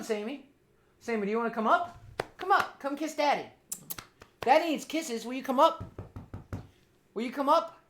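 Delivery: soft, lilting questions to a pet; coaxing pet talk. Real, not acted. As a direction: sweet-talk a dog with rising endings.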